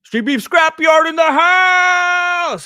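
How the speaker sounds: high, slow, loud shout-out; hype shout-out. Acted. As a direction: call it out like a host. Shouts out a greeting.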